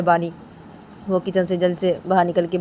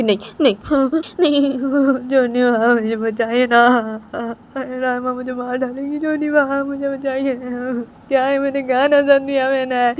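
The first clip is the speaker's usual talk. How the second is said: slow, higher, tearful begging; frightened pleading; 6 semitones higher, slower than usual. Acted. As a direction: plead for rescue, drawing each word out. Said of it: "तो बानी उसे बोलती है". (धीमी, डरी, रोती हुई गुहार)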